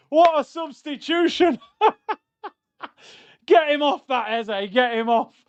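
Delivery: high, loud, laughing jeers; mocking his own earlier call, high and loud; gleeful shouting. Real, not acted. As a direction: crow at the screen while laughing. Celebrating goal, mocking earlier words.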